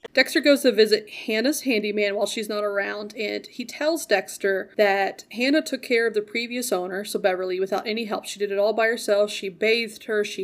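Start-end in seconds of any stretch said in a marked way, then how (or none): none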